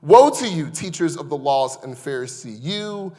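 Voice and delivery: loud, rising preaching of the woes; fiery scripture reading; forceful scripture reading. Acted. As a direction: proclaim the woes like a prophet.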